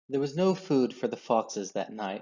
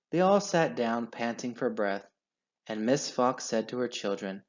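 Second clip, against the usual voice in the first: ordinary delivery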